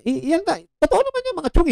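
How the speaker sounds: very high, emphatic; excited insistence. Real, not acted. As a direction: pitch it up sharply in disbelief. Vlogger insisting to viewers. (matinis at pasabik na paggiit)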